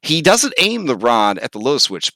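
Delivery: ordinary delivery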